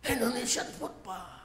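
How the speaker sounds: high-pitched wifely request; pleading wife. Acted. Wife asks husband for Indonesian food, high-pitched pleading. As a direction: call out high, then drop on the last word. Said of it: "istrinya ngomong".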